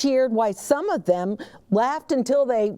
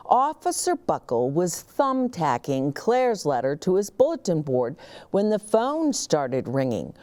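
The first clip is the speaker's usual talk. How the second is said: usual speaking voice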